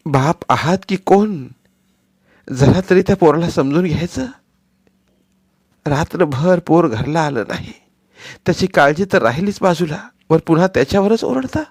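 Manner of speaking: loud, high outraged wife; wife yelling at her husband in anger; furious shout. Acted. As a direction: flare up and scold her husband. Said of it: "खवळून ओरडली".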